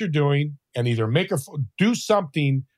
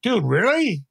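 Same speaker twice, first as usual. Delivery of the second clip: higher-pitched imitation of a stunned clerk; freaked out; 7 semitones higher, slower than usual. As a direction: act out incredulous disbelief. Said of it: "He was, like, all freaked out".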